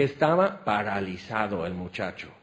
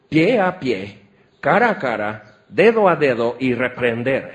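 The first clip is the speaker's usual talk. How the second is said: each phrase punched out louder; forceful emphasis; 6 dB louder than usual. Real, not acted. Preacher driving home what rebuke means, punched loudly. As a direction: hammer home the face-to-face image.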